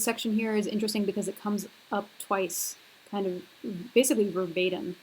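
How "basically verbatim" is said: usual speaking voice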